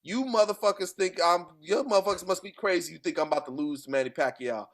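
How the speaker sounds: a hint of trash-talking bravado; mild mock brag; quoted boast. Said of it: "He said, and I quote".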